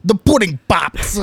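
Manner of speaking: high, loud Bill Cosby impression. Host doing his Cosby voice for co-hosts. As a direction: toss off a quick Cosby catchphrase. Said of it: "[name]'s Bill Cosby is spot on".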